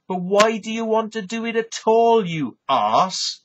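mock-yell at the farmer; retold angry rant. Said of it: "And I said".

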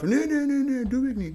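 high mock-innocent denial; hasty high-pitched promise. Classmate promises not to light it, high-pitched assurance.

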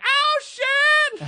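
very high, loud whooping cry; hooting yell. Retold or imitated. Women at a table whooping at the burn, very high loud hoot. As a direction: whoop like a crowd cheering a burn.

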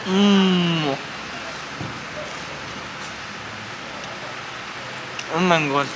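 muffled by cloth mask; speaking behind mask; through a face mask. Real, not acted.

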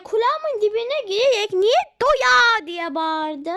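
put-on loud, slow childish outcry; loud slow kid grievance; loud complaint. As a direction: wail it at full volume like a wronged kid. Child complains about shouting.

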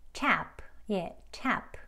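crisp one-syllable tap, not 탭프; native English tap. Shown as a demonstration. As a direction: tap with a puffed t and no vowel after the p. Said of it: "조금 다른 소리지요".

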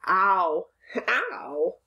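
"Ow" said as a clear ow vowel; ow sound. Shown as a demonstration. Teacher demonstrating the O-W sound to the class, clear ow. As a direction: model the ow sound of O and W. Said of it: "the O, W work together to say".

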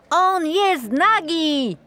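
the crowd calls out together; whole crowd shouting. Acted. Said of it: "zawołał cały lud". (okrzyk całego tłumu, głośniej i wyżej)

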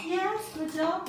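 high, slow beaming praise. Real, not acted. Teacher praising a small child.

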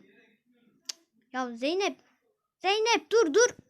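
usual speaking voice

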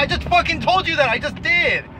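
high-pitched, exasperated outburst; exasperated retort. Retold or imitated. Retelling his angry retort.

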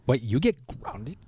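hushed, incredulous bratty-kid impression; mock a spoiled kid, softly, with swooping pitch; put-on kid voice, half-whispered disbelief; Peter. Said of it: "that's how he talks all the time".

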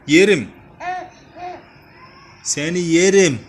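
loud giggly coo; laughing playfully. Real, not acted. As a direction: laugh through the words.

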